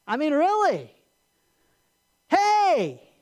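loud, high, goading indignation; tempter crying out. Acted. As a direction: stir up the listener's outrage. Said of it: "We hear this voice cry out to us".

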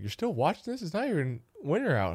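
mock the dad, disbelieving; dad's exasperated quote. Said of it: "he's like".

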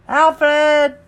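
very high, loud cartoon call. Cat calls for his friend, high loud voice. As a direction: holler the name up high.

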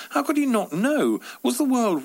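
high, incredulous cry; utterly bewildered by it; baffled disbelief. Acted. Narrator baffled by driver.

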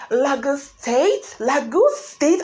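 "Lagos" mimicked as LAH-goos, drawn out and high; mimicked Lah-goose. Mocking a camp speaker's Lagos, exaggerated "LAH-goose State". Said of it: "and then she said it, like".

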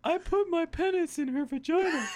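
laughing, squeaky-high mock confession; hammed-up high voice with a laugh in it. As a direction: put on a silly high pitch. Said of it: "like, high voice".

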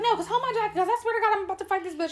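high, hammed-up indignation; mock a friend getting worked up; retold fighting talk. Alicia taking off her jacket to fight, hammed-up indignation.

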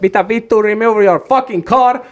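high, drawn-out mock yell; retold yell. Angry passenger tells a driver to move the car, retold yell. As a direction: yell it slowly, imitating your own outburst. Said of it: "Huudan sille sitä siellä".

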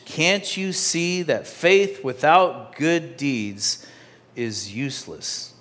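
ordinary talk

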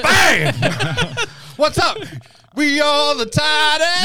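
high, loud, hyped-up yell; pumped-up shout. Pretend. Pumped after the movie, shouting the team chant. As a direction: roar it like a fired-up player.

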